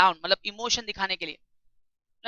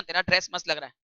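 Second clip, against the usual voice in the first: "तेरा ड्रेस मस्त" lower and softer, dry and mocking; sarcastic compliment; 5 semitones lower, 5 dB quieter than usual. Shown as a demonstration. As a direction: say the praise so it means the opposite. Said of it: "what is sarcasm".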